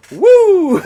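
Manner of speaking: high, loud woo; mock whoop. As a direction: let out a hammed-up whoop. Acts out a wrestler's mock cry.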